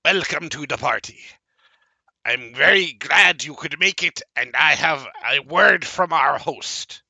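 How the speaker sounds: high, loud squeaky little creature voice; creature greeting. Acted. GM voicing a small bat-eared creature greeting newcomers, high and loud. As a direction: greet them like an eager little imp.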